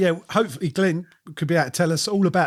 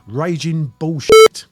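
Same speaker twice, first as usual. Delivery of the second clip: loud, slow announcer voice; announcer boom; 2 semitones higher, 10 dB louder, much slower than usual. Acted. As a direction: boom out the title slowly. Announcing the episode title.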